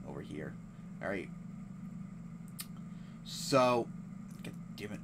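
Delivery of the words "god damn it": whispered annoyed curse; muttered curse. Real, not acted. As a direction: hiss it under your breath. Frustrated while searching.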